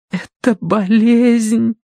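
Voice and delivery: very slow, high trembling voice; shaky voice. A narrator's reading. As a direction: tremble through it slowly. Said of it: "дрожащим голосом прошептала Киврин". (дрожащим голосом)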